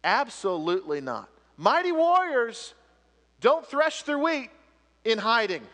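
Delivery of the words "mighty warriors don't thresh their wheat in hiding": big preacher's proclamation; proclaiming. Acted. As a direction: declare it like a herald.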